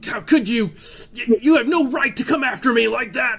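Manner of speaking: high, furious shriek of protest; outraged yelling. Acted. Captive woman yelling at her captors, outraged. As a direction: scream it at them, indignant. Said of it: "like, yelling and screaming at the".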